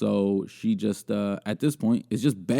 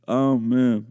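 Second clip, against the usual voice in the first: ordinary talk, nothing marked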